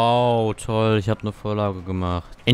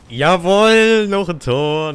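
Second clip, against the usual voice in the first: goal cheer; 5 semitones higher, 4 dB louder, much slower than usual. Real, not acted. Celebrating a goal.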